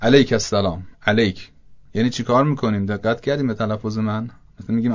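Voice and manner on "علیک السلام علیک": علیک with a kasra lam, Persian style; Persian-accented Arabic. Shown as a demonstration.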